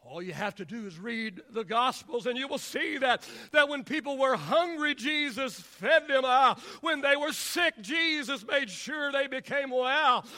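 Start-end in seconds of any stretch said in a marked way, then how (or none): 2.2-10.2 impassioned preaching. Acted. Preacher recounts what Jesus did for people, building fervently. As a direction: punch each clause in driving rhythm.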